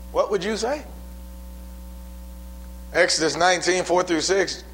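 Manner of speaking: ordinary talk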